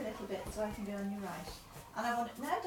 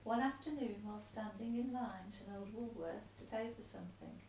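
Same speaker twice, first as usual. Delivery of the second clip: letter read aloud. Acted. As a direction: read the letter out steadily to him.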